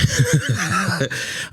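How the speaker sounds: a bright burst of laughter; laughing at himself. Real, not acted. Guest laughing at his own double negative. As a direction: laugh at the slip.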